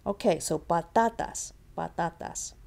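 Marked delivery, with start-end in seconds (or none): 0.6-1.5 "patatas" with the middle "ta" stressed; stressed middle syllable. Shown as a demonstration. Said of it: "Pa goes there". 1.8-2.5 "patatas" with the middle "ta" stressed; stressed middle syllable. Shown as a demonstration. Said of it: "Pa goes there".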